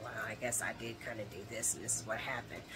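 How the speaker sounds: sheepish admission. Retold or imitated. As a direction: concede it quietly, like a guilty man. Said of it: "He should have been like".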